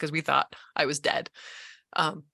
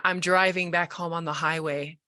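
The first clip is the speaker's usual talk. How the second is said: ordinary talk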